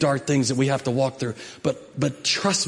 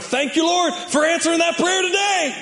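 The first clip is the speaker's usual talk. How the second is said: much higher, shouted praise; joyful thanks; 13 semitones higher than usual. Retold or imitated. Pastor shouting his grateful prayer to the congregation, much higher. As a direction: cry out thanks to the Lord in triumph. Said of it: "I was like".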